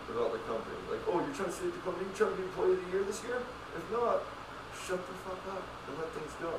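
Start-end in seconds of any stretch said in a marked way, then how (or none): 1.1-6.5 higher, taunting sneer; mock sneer. Taunting a worker chasing employee of the year, high and mocking.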